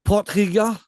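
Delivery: "Potgieter" with a throaty Afrikaans g; South African name pronunciation. Shown as a demonstration. Showing off the guest's surname, "Potgieter" in Afrikaans pronunciation. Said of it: "I thought that was quite good".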